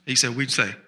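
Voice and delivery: ordinary delivery, nothing marked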